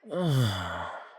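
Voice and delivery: long falling sigh. Acted. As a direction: let out a voiced sigh that drops away. Sighs before offering a drink.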